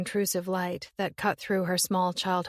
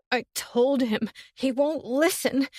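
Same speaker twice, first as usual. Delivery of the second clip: higher, louder, slowed with despair; breaking down; 6 semitones higher, 4 dB louder than usual. Acted. Summer breaking down over her boyfriend, voice cracking. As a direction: voice cracking as it climbs.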